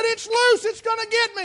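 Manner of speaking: high, loud, frightened cry; a panicked little girl sure the bee will get her; terrified child. Retold or imitated. Girl panics in the car that the bee is loose, high and loud.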